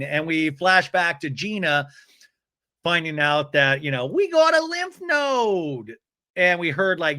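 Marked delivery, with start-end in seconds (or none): 4.1-6.0 high, stretched announcement; mock celebration. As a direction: cheer it out slowly in a raised pitch. Imitates Gina's celebratory announcement.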